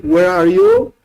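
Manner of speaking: "Where are you?" high and loud, rising at the end; demanding call. Shown as a demonstration. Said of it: "Don't read".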